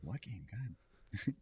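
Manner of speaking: soft, under-the-breath aside; muted retold bafflement. Retelling his own confusion to friends, soft and muted. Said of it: "I was like".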